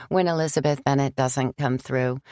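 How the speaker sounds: ordinary delivery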